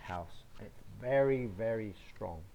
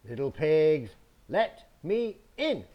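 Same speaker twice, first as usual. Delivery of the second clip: higher and louder, insisting; demanding wolf; 4 semitones higher, 9 dB louder than usual. Acted. Wolf demands entry to the wooden house, loud and high. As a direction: bang on the door with the words. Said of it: "He says".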